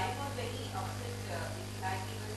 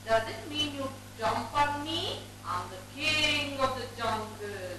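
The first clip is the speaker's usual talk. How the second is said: loud, higher lion voice, slowing down at the end; angry lion; 5 semitones higher, 6 dB louder than usual. Acted. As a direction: puff up like the offended king. Angry lion scolds the mouse.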